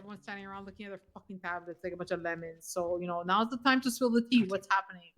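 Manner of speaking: very loud, furious rant; angry yelling. Acted. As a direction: rant at the top of your lungs. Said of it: "started yelling at me".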